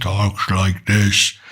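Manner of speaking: put-on slow it down and drop low like the old director; Hitchcock's voice. Vlogger lightly imitating the director's slow, low speech. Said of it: "I thought he spoke with a British accent".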